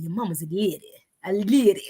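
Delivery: put-on New Orleans accent, drawling "lady"; Samuel Jackson. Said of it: "Samuel Jackson did that good".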